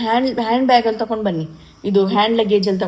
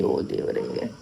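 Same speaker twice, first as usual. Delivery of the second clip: much softer, breathed-out whisper; whispered oh god in dismay; 10 dB quieter, slower than usual. Real, not acted. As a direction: let it slip out under your breath. Dismay over missing luggage.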